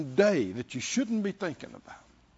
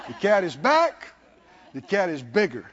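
usual speaking voice

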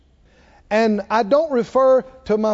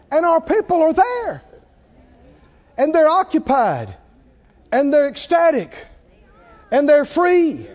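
full-force preaching; 9 semitones higher, 3 dB louder than usual. Real, not acted. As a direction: proclaim it to a roused congregation.

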